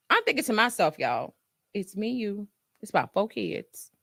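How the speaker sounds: ordinary talk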